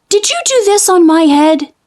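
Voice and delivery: high, earnest little mole; little mole asking. A narrator's reading. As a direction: ask it like a small puzzled mole. Mole asks the cow.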